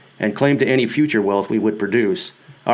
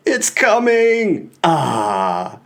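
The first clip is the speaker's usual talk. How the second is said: high, slow, drawn-out squeal of mock delight; hammed-up squeal of delight, very high; mock orgasmic squeal; 7 semitones higher, much slower than usual. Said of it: "squealing with mock orgasmic delight".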